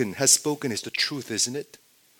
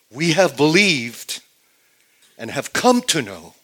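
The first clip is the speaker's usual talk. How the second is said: loud, drawn-out declaration; emphatic confession; 4 semitones higher, 8 dB louder than usual. Acted. Preacher voicing Peter's confession of belief, loud and emphatic. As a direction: proclaim it with full conviction.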